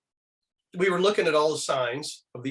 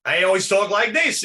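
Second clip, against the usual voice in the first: a hint of a cartoon dad, higher and louder; bright cartoon dad, pitched up a little; George Jetson; 6 semitones higher, 6 dB louder than usual. Retelling the Jetsons voice actor, lightly done cartoon dad. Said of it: "used to do the voice of George Jetson".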